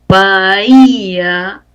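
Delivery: "bahía" very slow, vowels held; drawn-out example word. Shown as a demonstration. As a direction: sound out "bahía" slowly, lingering on each vowel. Teacher sounds out example word.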